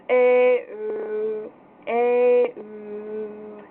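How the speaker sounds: "A" gliding to "oo" twice; ö practice drill. Shown as a demonstration. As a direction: round lips from "A" to "oo". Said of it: "it goes from A to O".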